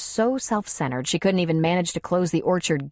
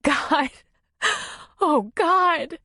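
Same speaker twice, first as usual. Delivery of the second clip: much higher, shaken sobbing cry; 10 semitones higher, much slower than usual. Acted. Woman sobs after the bear attack, crying. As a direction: cry out in shock.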